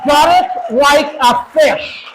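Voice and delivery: high, loud, slow and deliberate. Real, not acted. Speaker driving home gutting the swamp, loud and slow. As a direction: drag each word out over the crowd. Fiery call continues.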